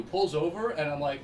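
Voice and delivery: normal talking voice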